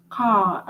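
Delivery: "Car" ending on a throaty French R. Shown as a demonstration. Teacher contrasting with an English speaker, "Car" with stressed French R. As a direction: let the R of "Car" scrape at the back of the throat. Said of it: "to emphasize on the R more".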